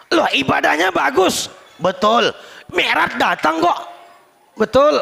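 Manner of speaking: high, loud back-and-forth; heated acted exchange. Acted quarrel before God over village head. (berdebat lantang bernada tinggi)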